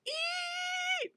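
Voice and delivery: very high, drawn-out, thin "eee"; high-pitched squeal. Acted. Player showing Meep's spell cry, high-pitched squeal. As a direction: hold a piercingly high squeak without pushing the volume. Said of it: "just a really high-pitched".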